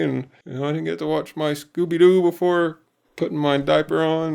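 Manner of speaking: high-pitched mock blubbering boy; sad, upset kid. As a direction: whimper and whine like an overwrought little kid. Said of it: "more anger mixed in his sadness".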